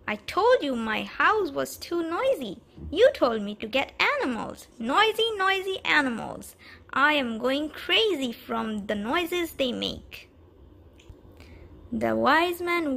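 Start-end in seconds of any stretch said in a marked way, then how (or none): 0.1-10.3 higher, louder frantic complaint; angry yell. Acted. The man yells at the wise man, angry. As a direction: yell at him, fed up. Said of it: "He yelled at the wise man".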